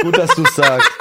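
laut durch Lachen gesprochen; lachend. Real, not acted. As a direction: lacht den Satz heraus. Lacht über den Podcast-Witz. (laut lachend gesprochen)

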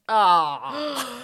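loud drawn-out collective groan, imitated; loud mock "ahh" of dismay; crowd's disappointed "ah". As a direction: mimic a let-down audience going "ah". Acting the crowd's reaction to news.

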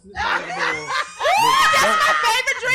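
slow, high, loud, laughing gush; excited. Real, not acted. Cohost gushing over her favorite malt, laughing excited squeal.

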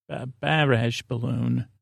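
slow, soft "barrage" with flat Syracuse "a" vowels; Syracuse accent. Shown as a demonstration. Host testing the hometown pronunciation, "barrage" in a quiet, slow Syracuse accent. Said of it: "what would I say in Syracuse?".

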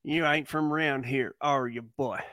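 put-on Southern local's challenge; mock Alabama accent. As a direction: tease with an Alabama twang. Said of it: "you're from Alabama".

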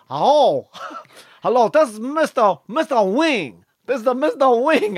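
high caricatured Chinese phone voice; mock Chinese accent. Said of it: "he gets a fucking Chinese takeaway".

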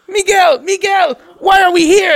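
put-on high, loud squeaky kid sidekick; Short Round. Body part to its owner, squeaky sidekick voice. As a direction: pipe up in an urgent child's voice. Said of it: "talks to me like Short Round".

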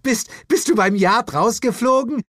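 high, loud, through laughter; laughing. Acted. Jane mocks the Santa costume, high and laughing. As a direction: stammer it out while laughing. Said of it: "fing schallend an zu lachen".

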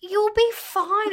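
high, drawn-out reassurance; retold reassurance. Girlfriend to fretting boyfriend, exaggeratedly reassuring. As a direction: stretch it out high to brush off his worry.

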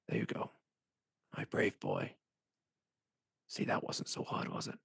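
low, whispery murmur of praise; hushed, patronizing voice. Acted. A remembered voice praising a brave boy, hushed and whispery. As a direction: murmur it softly, as if praising a child. Voice heard in Vera's flashes.

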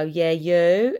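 loud "Yeah, you" with rising end; mock confrontational reply; pointed comeback. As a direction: fire it back loudly in a mock row. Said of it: "And then they'd go".

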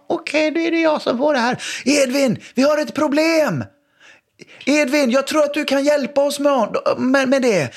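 high, theatrical game-master voice; playful game voice. Retold or imitated. Dad calling his son into a pretend mission, high theatrical voice. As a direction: sell it like a grand adventure to the kids.

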